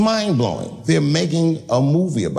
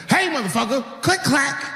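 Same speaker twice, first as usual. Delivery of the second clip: high, barked warning with a racked gun; menacing threat; 10 semitones higher than usual. Acted. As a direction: scare the intruder off. Threatening intruder with shotgun.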